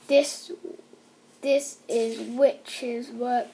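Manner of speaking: usual speaking voice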